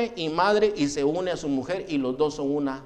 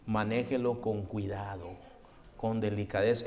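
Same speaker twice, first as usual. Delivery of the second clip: gentle, careful; 8 semitones lower, 7 dB quieter than usual. Acted. Gentle handling of fragile box.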